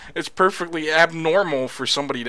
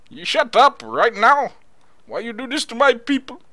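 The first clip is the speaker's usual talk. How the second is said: put-on Indian accent, higher and indignant; mock Indian accent; 3 semitones higher, 5 dB louder than usual. Man berating the others for his people, mock Indian accent. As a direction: scold in a hammed-up Indian accent. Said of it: "Where the heck is that accent from?".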